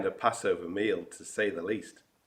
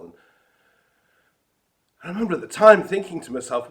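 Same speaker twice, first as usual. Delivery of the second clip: ordinary delivery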